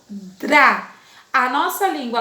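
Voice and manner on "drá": Drá with a trilled, scratchy R; scratched R. Shown as a demonstration. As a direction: roll the R in Drá. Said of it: "Olha só como arranhou". (Drá com o R arranhado)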